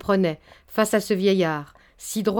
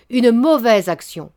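louder, slower, hammering it home; more forceful; 3 semitones higher, 7 dB louder, slower than usual. Acted. Baron repeating his accusation to Nantas, louder and slow. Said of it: "il répéta avec plus de force".

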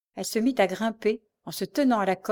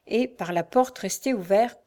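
usual speaking voice

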